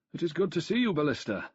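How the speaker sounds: put-on light Danubian accent. A narrator's reading. Old ex-consul greets Ballista with a lighter Danubian accent. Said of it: "The Danubian accent was less pronounced".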